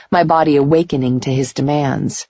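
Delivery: usual speaking voice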